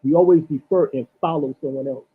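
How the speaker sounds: ordinary talk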